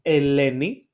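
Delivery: Eleni said loud and clear, first sound E; clear model word. Shown as a demonstration. Teacher modelling the Greek name Eleni, loud and clear. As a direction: sound out Eleni for the class to copy. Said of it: "put some examples here".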